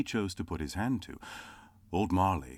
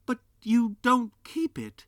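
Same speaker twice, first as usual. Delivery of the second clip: high, louder insistence; insistent nephew; 7 dB louder than usual. Acted. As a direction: press the point. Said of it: "repeated Scrooge's nephew".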